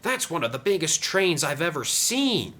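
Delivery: high, amazed exclamation; gasping in awe. A narrator's reading. Oliver watches Sam's train, amazed. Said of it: "gasped Oliver".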